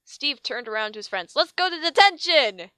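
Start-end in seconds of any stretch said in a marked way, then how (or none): 1.3-2.7 high, loud eager cheer; rally the gang cheerfully; excited cheer. Acted. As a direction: Steve cheers on his friends.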